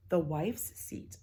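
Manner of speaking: ordinary delivery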